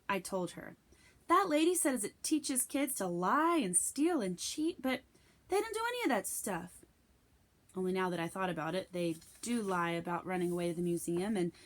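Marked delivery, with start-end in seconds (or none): 1.3-6.8 put-on slow, higher girl's voice rising into a squeak; girl defending the banned book, higher and slow; voiced young girl. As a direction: play the indignant classmate. Rebecca protesting, voiced girl.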